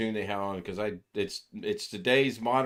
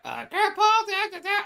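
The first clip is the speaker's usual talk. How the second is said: put-on very high squeaky child voice; imitating granddaughter; 5 dB louder than usual. Granddaughter mimicking Grandpa's friend in a very high voice. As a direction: squeak it up high like a little girl. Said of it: "she tried to change her voice".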